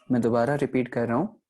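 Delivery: normal talking voice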